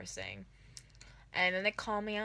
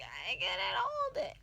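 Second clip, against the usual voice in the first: high, slow sob-laugh cry; 11 semitones higher, slower than usual. Retold or imitated. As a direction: blurt it out while sobbing. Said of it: "And, like".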